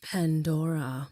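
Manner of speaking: slow, low and sultry; sexy voice. Pretend. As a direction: purr the name seductively. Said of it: "in the most sexy voice".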